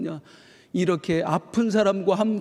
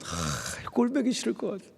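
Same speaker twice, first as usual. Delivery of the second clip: mock disgust; 5 semitones higher, 6 dB quieter than usual. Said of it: "저 같으면".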